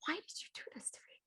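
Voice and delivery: fast, hushed desperate whisper; panicked whisper. Retold or imitated. Cosplayer handed a baby on a rail, fast frantic whisper. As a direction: whisper it in rising alarm. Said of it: "I was like".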